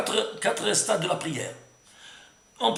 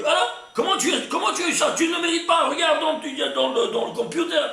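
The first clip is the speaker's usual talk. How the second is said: bar the way like a suspicious gatekeeper; angels challenging; 7 semitones higher than usual. Acted. Angels at the gate confront the one praying, high and indignant.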